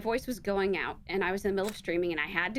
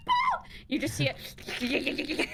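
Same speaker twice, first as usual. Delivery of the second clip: mock monster squeak; 4 semitones higher, much slower than usual. Mimicking crawling revenant.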